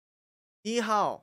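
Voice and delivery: slow, failed stab at a Hong Kong accent; attempted Hong Kong accent. Shown as a demonstration. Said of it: "I can't really do a Hong Kong act".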